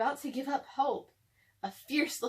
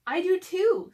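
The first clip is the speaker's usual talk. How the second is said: higher and louder; delighted; 8 semitones higher, 9 dB louder than usual. Acted. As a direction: say it with glee. Chester delighted, matching answer.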